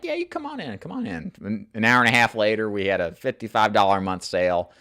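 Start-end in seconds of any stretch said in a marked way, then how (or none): 0.0-1.3 high, softer, lightly voiced welcoming woman; calmed-down lady. Calmed homeowner inviting the agent inside, a high soft mimic. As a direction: imitate her inviting him in, now soothed. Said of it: "and she calmed down".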